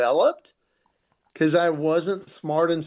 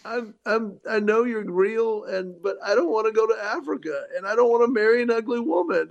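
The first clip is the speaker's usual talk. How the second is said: higher, rushed mock panic; retold freaking out; 7 semitones higher than usual. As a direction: plead with God in a comic panic. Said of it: "I remember just freaking out".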